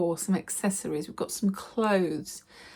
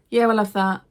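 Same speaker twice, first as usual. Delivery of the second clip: louder, brusque take on the man's words; curt shop man; 3 semitones higher, 6 dB louder than usual. Retold or imitated. Charity shop man picking through her bag, louder and brusque. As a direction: play the dismissive sorter grabbing items.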